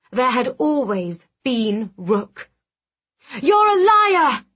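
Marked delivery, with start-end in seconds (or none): 3.4-4.5 high, loud accusing shout. A narrator's reading. Narrator finally rejects her aunt's taunts, shouting. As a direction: shout it out in furious denial. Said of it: "I shouted".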